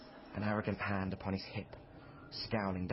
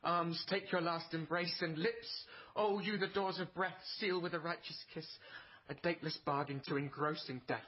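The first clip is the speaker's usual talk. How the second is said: theatrical Shakespeare recital, pitched up; hammy Romeo; 7 semitones higher than usual. Acted. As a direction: play the dying lover for the parlour. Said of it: "Romeo and Juliet".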